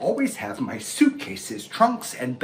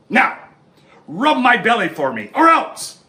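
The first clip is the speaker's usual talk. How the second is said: loud high bark at a subject; bossy lion; 8 semitones higher, 7 dB louder than usual. Acted. Lion ordering the animals, loud and bossy. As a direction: order them around. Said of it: "bossing everyone around".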